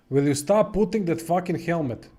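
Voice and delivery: exasperated yelling at a stubborn character; annoyed yell. Real, not acted. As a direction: let it burst out at the game.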